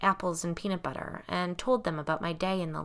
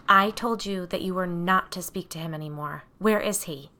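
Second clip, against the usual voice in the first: ordinary delivery, nothing marked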